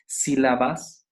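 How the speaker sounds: ordinary talk, nothing marked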